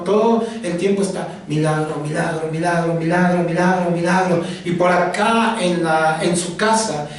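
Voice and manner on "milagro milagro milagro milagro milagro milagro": rapid repetitive chant on one note; trance-like prayer. Acted. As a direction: keep repeating it like someone lost in prayer. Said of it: "entra como en un trance". (rezo repetitivo, como en trance)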